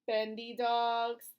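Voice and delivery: ordinary delivery, nothing marked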